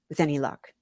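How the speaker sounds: usual speaking voice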